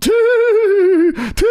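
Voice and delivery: loud high-pitched hooting noise; high, loud drawn-out "too"; imitated noise. Telling friends about the faceplant, high imitated noise.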